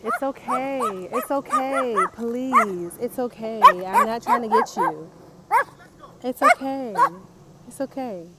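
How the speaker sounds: high sing-song pet voice, loud and gentle; coaxing the dog. Real, not acted. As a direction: coo reassurance to a nervous little dog. Soothing a small dog approaching.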